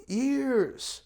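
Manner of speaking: a hint of an eager elderly woman insisting; old lady. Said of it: "she was eighty-eight years old".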